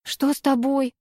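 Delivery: lightly alarmed question; understated shocked question; gasping. A narrator's reading. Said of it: "ахнула я".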